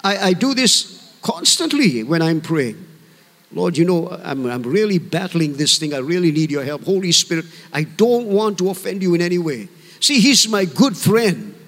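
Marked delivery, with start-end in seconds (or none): 3.5-9.7 lower, conversational plea to God; gentle prayer. Acted. Believer telling the Lord of a battle, gently praying. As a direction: talk to Him simply, like a good friend. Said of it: "talk just gently to Him".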